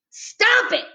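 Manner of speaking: high, loud yell. A narrator's reading. Boy yelling at the girl to leave him alone. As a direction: shout him down. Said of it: "he yelled".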